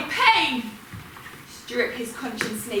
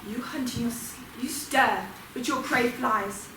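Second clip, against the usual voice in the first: quiet reproach; 8 dB quieter than usual. Acted. Ghost chiding the dreaming Furies, quietly reproachful. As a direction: drop right down to a soft reproach. Scorning the sleepers' dream hunt.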